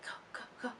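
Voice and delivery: hushed urgent whisper. Retold or imitated. Tour manager waving fans in, whispered urgently. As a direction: whisper it quick and low. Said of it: "he was just like".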